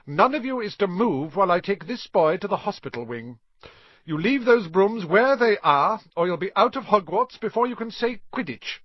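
high, loud warning to the class; stern teacher. A narrator's reading. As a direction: lay down the law, projecting. Teacher warns the whole class.

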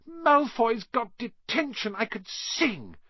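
high, slow gleeful delight. A narrator's reading. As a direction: schoolgirl gloating happily. Hermione jigging with joy.

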